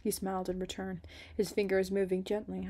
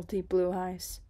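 ordinary delivery, nothing marked

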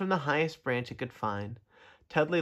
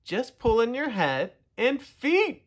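high, slow yelled instruction; yelling; 9 semitones higher, slower than usual. A narrator's reading. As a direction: yell it up slowly, word by word. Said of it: "yelled the turtles".

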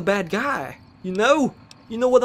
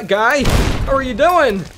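ordinary talk, nothing marked

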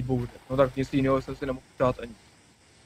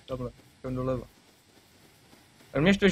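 ordinary delivery

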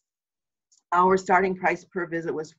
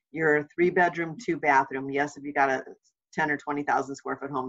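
usual speaking voice

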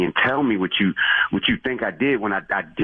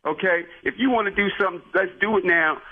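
higher-pitched indignant dare to meet up; heated challenge; 7 semitones higher than usual. Real, not acted. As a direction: rise up and call him out. Attendant offers to settle it now.

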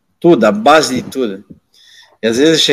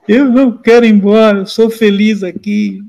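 pitched-up whine, slowing down; whining plea, retold; 7 semitones higher than usual. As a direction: imitate a boy begging to stay. Boy crying, begging to stay.

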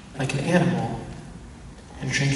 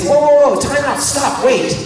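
much higher, loud cry of whoa, stop; imagined alarmed halt; 11 semitones higher, 7 dB louder than usual. Pretend. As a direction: yell to stop everything right now. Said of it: "where he'd say".

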